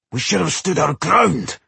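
high, cross complaint. A narrator's reading. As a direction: grumble crossly at a companion. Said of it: "Boendil whispered crossly".

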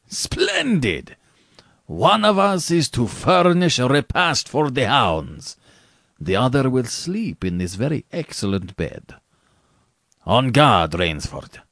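high, ringing delight of a gentlemanly villain; courtly general, exultant. A narrator's reading. General challenges Rainsford to a last duel, grandly exultant. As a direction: announce the duel with grand relish.

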